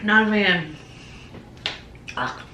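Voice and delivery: talking with food stuck to the roof of the mouth; chewy mouthful while speaking; mouth full of flapjack. Real, not acted. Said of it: "It's, like, stuck to the roof of my mouth".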